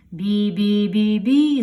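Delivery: slow loud horn beeps; revving honk. Acted. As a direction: rev up with honking. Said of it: "заводиться ваговіз". (сигнал ваговоза)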